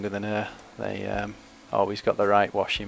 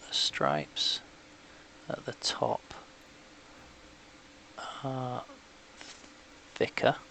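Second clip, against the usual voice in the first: holding breath; 3 semitones higher, 7 dB quieter, slower than usual. Real, not acted. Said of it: "holding my breath again a little bit here".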